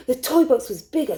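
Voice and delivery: plain reading voice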